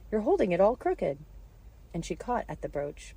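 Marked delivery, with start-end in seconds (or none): 0.1-1.2 loud, high, urgent warning; cried out. A narrator's reading. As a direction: exclaim in alarm. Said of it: "cried Alice".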